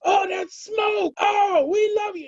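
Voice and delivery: retold fan screaming, high and thrilled; excited fans shouting. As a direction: yell it like fans spotting a star. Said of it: "people just started yelling and screaming".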